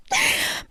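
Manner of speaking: a quick, airy little laugh; soft breathy laugh. Acted. At you trying to act brave, a breathy amused laugh.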